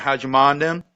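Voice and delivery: "mind them" run together as MA'N'EM; "How'd you mind them" slurred into how's-ya-MA'N'EM; Southern contraction. Shown as a demonstration. Storyteller introduces a Southern saying, run together as ma'n'em. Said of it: "you're mentioning the mother".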